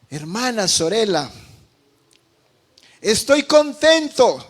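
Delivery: retold joyful cry, rising high and loud at the end; shouting for joy. Italian boy tells the pastor's wife his news, joyful shouting. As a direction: imitate an overjoyed child, stretching out the happy news. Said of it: "vino saltando y gritando de felicidad".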